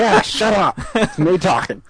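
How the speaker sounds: a hint of a creaky old geezer; old man voice. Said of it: "Where'd the old man voice come from?".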